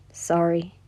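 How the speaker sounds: low, soft mumbled apology. Acted. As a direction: mutter it under your breath. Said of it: "he mumbled".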